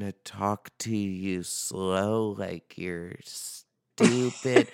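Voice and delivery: slow, over-careful talk to a foreigner; slow broken English. Retold or imitated. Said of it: "that, like, broken English thing where I'm like".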